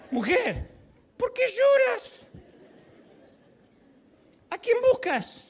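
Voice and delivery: high, drawn-out put-on questions; acted Jesus, pitched well above normal speech; Jesus questioning. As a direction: call out each question high and slowly, with pauses. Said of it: "Y Jesús le dice".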